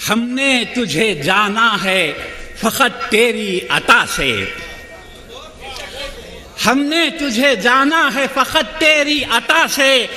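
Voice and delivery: measured, rhythmic mushaira delivery; stately nazm recitation. Acted. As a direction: recite each couplet with gravity.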